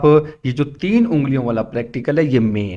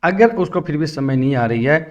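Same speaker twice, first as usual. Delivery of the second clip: normal talking voice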